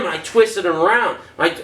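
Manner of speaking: ordinary talk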